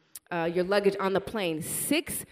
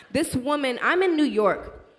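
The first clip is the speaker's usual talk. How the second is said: usual speaking voice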